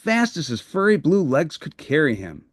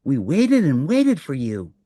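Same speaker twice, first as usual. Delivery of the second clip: Prairie Dawn voice. Acted.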